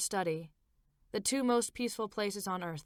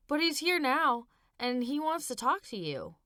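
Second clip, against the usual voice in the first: put-on excited child; 7 semitones higher, 3 dB louder than usual. Child pleading happily.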